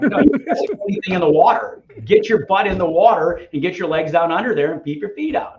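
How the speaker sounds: bossy coach. Retold or imitated. Coach voiced, louder, higher.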